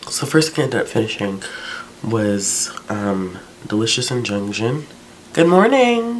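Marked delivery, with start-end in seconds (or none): 5.3-6.2 much higher, louder, bright morning hello; high sing-song greeting. Real, not acted. Calling a cheerful good morning to someone just up. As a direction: greet them in a sugary high voice.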